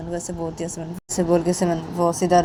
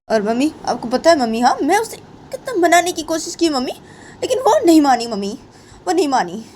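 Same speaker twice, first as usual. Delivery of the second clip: heated complaint, often jumping higher; aggrieved angry Simran; 7 semitones higher, 7 dB louder than usual. Acted. Simran tells her mother Sunaina made her this way, indignant. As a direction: protest hotly, blaming the other girl.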